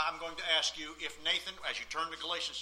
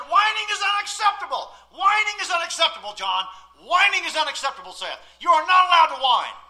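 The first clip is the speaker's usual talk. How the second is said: loud, high, repeated father's scolding; stern father rule; 10 semitones higher, 11 dB louder than usual. Retold or imitated. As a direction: lay down the rule to his sons. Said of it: "I said".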